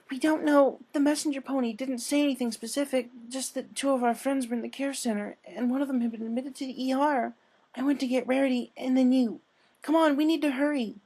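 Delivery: high, breathless, urgent plea; a timid mare voice pushed into a rush; high-pitched panicked Fluttershy at the door. Acted.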